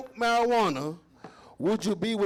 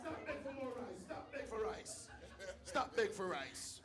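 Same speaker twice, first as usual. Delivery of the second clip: put-on quiet Jamaican accent, annoyed; 3 semitones higher, 15 dB quieter than usual. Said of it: "employees at Jamaican restaurants".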